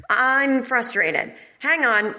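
high, slow, mock-dramatic huff; mock huffy exit. Woman storming out of a New York class, mock huffy and drawn out. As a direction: drag out a fake storm-off.

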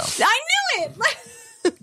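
high-pitched gleeful exclamation; triumphant. Real, not acted. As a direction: burst out high, vindicated. Mock triumph at clown confession.

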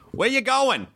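put-on high, thick Boston-accent driver; exaggerated Boston accent, pitched high; caricatured Boston accent. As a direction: imitate a cartoonishly Bostonian cabbie. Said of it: "the most caricaturized Boston accent I've ever heard".

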